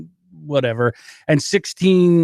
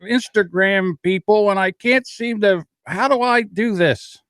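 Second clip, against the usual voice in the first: put-on lost old grandpa voice; 8 semitones higher than usual. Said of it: "I felt like grandpa trying to play video games".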